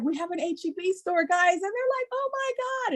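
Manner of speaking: put-on excited kids. Kids voiced, thrilled.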